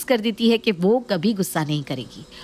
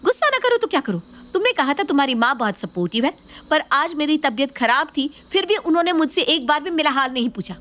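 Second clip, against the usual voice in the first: much higher, fast, furious complaint; angry outburst; 7 semitones higher, faster than usual. Acted. Wife vents at husband about his mother, high and furious. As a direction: let the anger burst out at him. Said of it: "इतना गुस्सा क्यों कर रही हो?".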